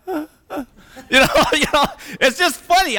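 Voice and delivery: high-pitched laughter through the words; giggling while trying to speak; laughing. Real, not acted. Speaker laughing at his mixed family story, real giggles.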